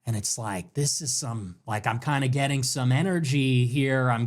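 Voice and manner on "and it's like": ordinary delivery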